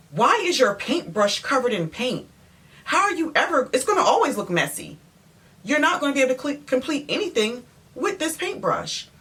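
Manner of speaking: high-pitched, incredulous telling-off; retold exasperated challenge, pitch swooping high; exasperated scolding. Acts out scolding the painter.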